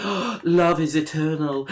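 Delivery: hammed-up romantic adoration; mock swooning. Onlookers gushing over an old man with flowers, mock swooning.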